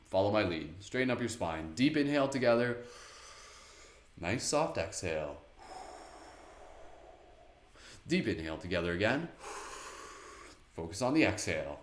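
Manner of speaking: calm breathing instructions; guided meditation. Acted.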